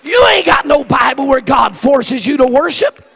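high, loud, whooping sermon climax; stretched-out shouted praise at the peak; preaching at full force. Acted. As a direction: lift the congregation with every line.

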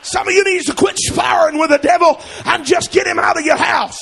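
loud, high sermon shouting; full-force preaching. Acted. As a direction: drive it home to the congregation.

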